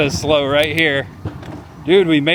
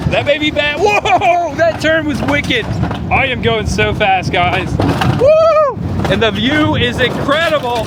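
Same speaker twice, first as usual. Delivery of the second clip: excited high voice; 7 semitones higher than usual. Real, not acted. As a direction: whoop and shout through the turns. Still on fast coaster.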